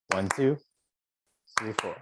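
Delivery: a tiny comma pause after two. Shown as a demonstration. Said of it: "So one more time".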